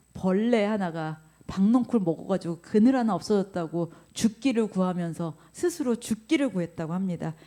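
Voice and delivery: usual speaking voice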